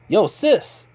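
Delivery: high, eager call out; lively high-pitched hello; excited greeting. Acted. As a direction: greet a sister brightly, pitched well up. Naruto greets his arriving sister.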